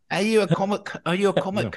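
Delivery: put-on high-pitched New Zealand accent query; mock-excited Kiwi questioning; Kiwi customs officer. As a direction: play along as an eager Kiwi official.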